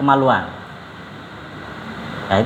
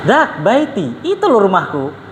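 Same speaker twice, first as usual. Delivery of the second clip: higher, baffled and laughing as the Yemeni host; confused host; 5 semitones higher than usual. Retold or imitated. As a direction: laugh through it, why wait here. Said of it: "Orang Yamannya bingung".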